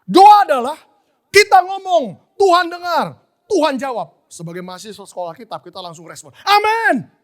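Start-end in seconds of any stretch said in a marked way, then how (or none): 0.0-4.3 loud, high, chant-like proclamation; emphatic teaching. Retold or imitated. Lecturer's definition proclaimed to class. 6.4-7.2 high, shouted class response; loud amen. Retold or imitated. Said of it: "kita amin kenceng gitu".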